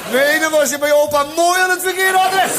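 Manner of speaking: high, rising mock outrage; indignant rebuke. Grandpa after the child's cheek, indignant. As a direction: build it up like a cross grandfather.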